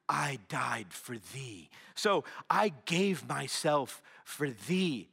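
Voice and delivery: slow, low, hushed biblical voice; drawn-out reverent Jesus line; solemn King James Jesus. Retold or imitated. Pastor imitating the actor Jesus to another group, slow low solemn voice. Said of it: "Like he was speaking King James".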